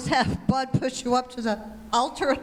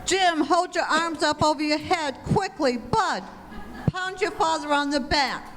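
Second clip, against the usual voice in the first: higher, frantic orders; raised, urgent voice over a choking emergency; urgent alarm; 4 semitones higher than usual. Acted. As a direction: take charge in a panic. Mother panics as husband chokes.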